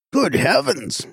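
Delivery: high, alarmed exclamation; excited cry. A narrator's reading. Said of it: "he cried excitedly".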